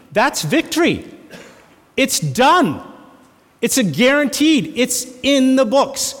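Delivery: high, ringing preacher's proclamation; fiery pulpit declaration; preaching at full force. Acted. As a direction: proclaim the victory to the congregation.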